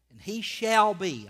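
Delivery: SHALL hit high, loud and slow; stress on SHALL BE. Real, not acted. Preacher underlining two words for his church, stress on SHALL BE. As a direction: lean hard on shall be. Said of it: "underline those two words".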